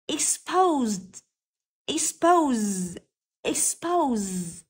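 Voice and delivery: Expose ending on a long voiced z; buzzing z sound. Shown as a demonstration. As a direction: draw out the buzz closing Expose. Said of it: "được phát âm là âm z". (phát âm Expose với âm z rung ở cuối)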